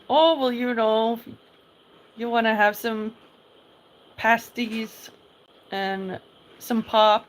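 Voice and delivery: Michigan accent, loud and drawn out; inland north accent. Shown as a demonstration. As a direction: show off a thick Michigan accent. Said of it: "How does the Michigan accent sound like?".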